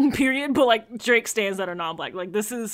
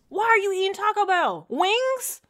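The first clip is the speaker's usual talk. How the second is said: high-pitched, baffled challenge; incredulous questioning; 10 semitones higher, slower than usual. Acted. Incredulous scolding of Taco Bell eaters.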